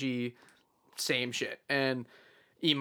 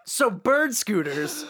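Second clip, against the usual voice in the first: slow, drawn-out higher announcement; mock awkward host; 6 semitones higher, 3 dB louder, much slower than usual. Retelling his awkward silence, slow hammed-up announcement. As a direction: announce a limp topic after dead air. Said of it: "and then I just say".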